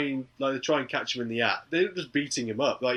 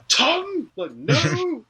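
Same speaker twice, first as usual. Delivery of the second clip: much higher, louder "Tom?" and "no"; hammed-up disbelief; mock shock; 5 dB louder, much slower than usual. Dad reacts in shock.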